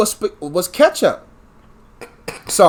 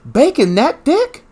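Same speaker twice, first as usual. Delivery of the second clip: amazed; 5 semitones higher, slower than usual. Retold or imitated. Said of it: "I was like".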